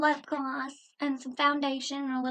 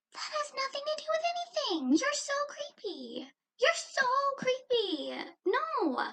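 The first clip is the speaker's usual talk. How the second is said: much higher, slow disgust; high, slow outraged disgust; disgusted outrage; 11 semitones higher than usual. Real, not acted. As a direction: drag out how creepy he is, high. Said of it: "outraged reaction".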